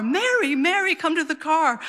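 high-pitched call from afar. Retold or imitated. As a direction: call her name from the car, pitch well up. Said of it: "he calls for my mom from the car".

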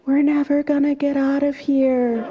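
imitated scream. Retelling her friend's cry from a rock in the river, mock scream. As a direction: wail it up to the sky at a held high pitch. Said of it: "and screamed".

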